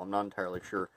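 ordinary delivery

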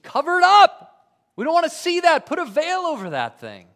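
high, loud, panicked crowd crying out; frantic plea to cover the glowing face; terrified shout. Acted. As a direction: scream it like people begging in terror. Terrified crowd begs Moses.

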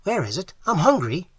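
high, hungry eagerness; affected eagerness. A narrator's reading. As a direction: pipe up eagerly, laying it on. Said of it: "replied Falabai with affected eagerness".

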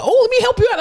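high-pitched, chirpy offer of help; eager helpful patient. Retold or imitated. Patient butting in to help the quizzed student, eager. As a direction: imitate an over-keen patient butting in.

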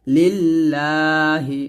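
লিল্লাহি with the লা held one beat. Shown as a demonstration. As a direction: stretch লা in লিল্লাহি by a single count. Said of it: "লাতে এক লিপ টান দিবেন".

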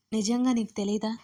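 plain reading voice

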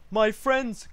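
high, loud, urgent hail; crying out. A narrator's reading. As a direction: cry out to long-sought friends. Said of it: "cried the elephant".

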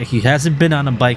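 normal talking voice

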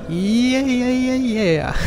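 high joyful yeahs; excited cheer. Real, not acted. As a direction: whoop with victory. Victory celebration.

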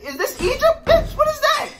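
high incredulous laughter at the screen; baffled laughing. Real, not acted. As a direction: squeal your confusion.

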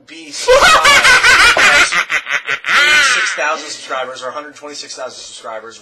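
over-the-top YouTube intro parody; hyped YouTuber voice. Retold or imitated. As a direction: ham up a YouTuber reading his subscriber count. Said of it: "YouTube has developed this sound of".

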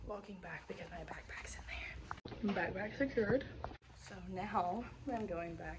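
soft unvoiced whisper. Real, not acted. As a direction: whisper so nobody hears. Whispering while walking.

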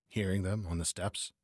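ordinary delivery

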